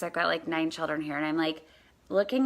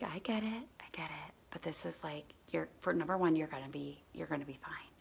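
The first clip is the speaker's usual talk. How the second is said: hushed, near-whispered comforting; quiet reassurance; 10 dB quieter than usual. Retold or imitated. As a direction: soothe a scared child very softly. Reassuring the scared child.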